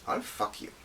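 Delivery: dropped to a near whisper; breathy punchline. Acted. Drea shocks the recruits, near-whispered and sly.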